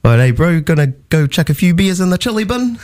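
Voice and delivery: high, broad New Zealand accent; hammed-up Kiwi bloke. Shown as a demonstration. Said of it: "in, in Kiwi".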